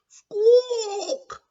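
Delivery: loud, very high bird squawk; pigeon squawk. Acted. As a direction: screech it like a startled pigeon. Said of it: "squawked the bird".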